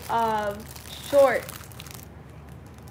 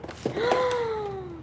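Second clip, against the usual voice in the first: delighted gasp; 5 semitones higher, 4 dB quieter than usual. Real, not acted. Delighted gasp at book bag.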